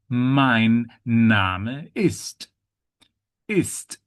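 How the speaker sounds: "mein Name ist" spaced out, final t released; clear word endings. Shown as a demonstration. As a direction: model "mein Name ist" for learners, every ending sounded. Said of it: "letters that are at the end".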